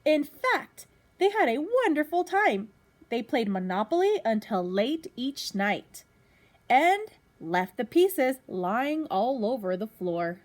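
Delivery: lively storybook reading to a class; reading to children. Acted. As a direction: read it out like a teacher at story time. Teacher reads picture book to class.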